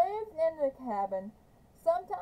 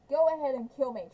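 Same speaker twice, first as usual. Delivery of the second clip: fast defiance; 5 dB louder, much faster than usual. Acted. Kate defying Trout.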